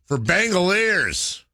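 high, slow, drawn-out announcer call; home run call. Pretend. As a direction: belt it like a stadium announcer. Mimicking the ballpark home run calls.

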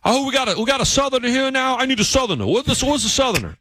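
high-pitched, frantic mock coworker; imitated New England coworker. Coworker searching the screening room for a Southerner, high mock panic. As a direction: call out high, as if urgently hunting for someone. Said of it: "one of my New England coworkers".

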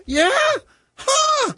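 high-pitched imitated "huh" across a room; yelled query. Father still puzzled across the room, imitated yell. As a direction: holler back, not catching on.

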